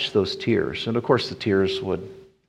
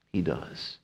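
soft answer; 6 semitones lower, 11 dB quieter than usual. Acted. Soft answer after shout.

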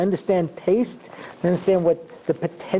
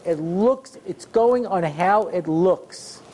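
usual speaking voice

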